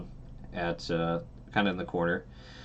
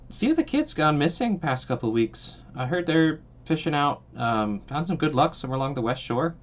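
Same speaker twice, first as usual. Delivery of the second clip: a lightly voiced, higher townsfolk voice; tavern gossip; 8 semitones higher, 4 dB louder than usual. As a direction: pass on the rumour like a chatty local.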